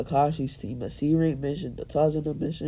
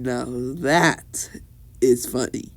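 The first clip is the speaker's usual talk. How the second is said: plain reading voice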